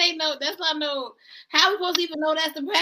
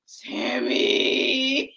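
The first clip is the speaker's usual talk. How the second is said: usual speaking voice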